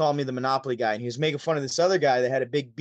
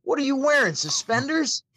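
mocking comedian; 11 semitones higher than usual. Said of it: "He goes".

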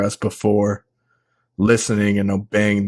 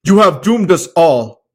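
shouting; 9 semitones higher, 8 dB louder than usual. Acted. Meteran scolds the mob.

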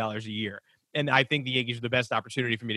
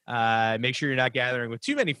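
usual speaking voice

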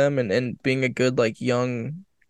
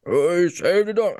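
slow, put-on big-man impression, higher than usual; Shaq; 4 semitones higher, slower than usual. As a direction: attempt a famous athlete's voice, slowly and badly. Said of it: "let me get my Shaq voice".

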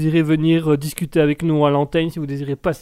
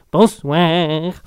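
lightly voiced granny, drawn out and louder; old granny; 5 dB louder than usual. Granny introduced on air greets listeners, hint of old woman. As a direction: greet them as a little old lady, stretched out. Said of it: "je vous présente Mamie Duck que vous venez d'entendre".

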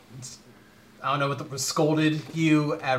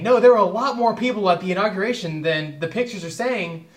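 mock insistence, higher-pitched; insistent denial; 6 semitones higher, 4 dB louder than usual. Mimicking Trump's people defending the inauguration crowd, insistent. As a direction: protest it in a raised mocking voice.

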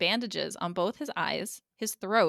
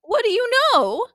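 high, loud mock outrage; high-pitched incredulous outburst; exasperated challenge; 14 semitones higher, 9 dB louder than usual. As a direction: demand it in baffled disbelief. Acted baffled challenge to character.

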